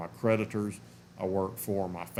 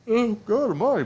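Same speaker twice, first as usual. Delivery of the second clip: yawning; 13 semitones higher, 8 dB louder than usual. Real, not acted. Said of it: "pardon me".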